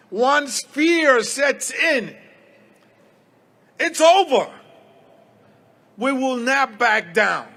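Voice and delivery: defiant rally shout; rally speech. Real, not acted. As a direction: punch it out to a crowd.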